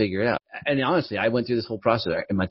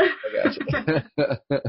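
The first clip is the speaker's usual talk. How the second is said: high laughing "I got you", much higher; laughing agreement; 5 semitones higher than usual. Real, not acted. Host laughs as guest explains pronouns, laughing high.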